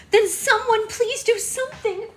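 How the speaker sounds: a bride crying out to everyone; hysterical crying. A narrator's reading. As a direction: scream it through tears. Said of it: "Red cried hysterically".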